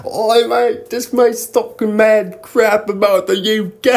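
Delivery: high, loud fake British accent; mock British accent. A fake British guy complains about an American, in a hammed-up British accent. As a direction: ham it up as a bloke saying mate. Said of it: "fake British guy".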